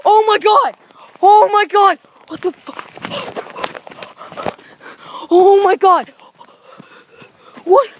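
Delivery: high frightened shrieks of oh my god; panicked screaming. Real, not acted. Startled by something in game.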